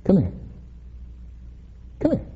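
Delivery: soft, repeated coaxing call. Retold or imitated. Jogger turning his hand over to a dog, quiet coaxing. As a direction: gently beckon a nervous little dog. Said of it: "in a quieter voice".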